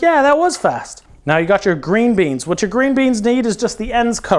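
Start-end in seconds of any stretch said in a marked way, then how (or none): 0.0-1.0 higher, slow self-satisfied yeah; higher smug agreement. Retold or imitated. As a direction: answer yourself, pleased and a bit higher. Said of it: "And you're like".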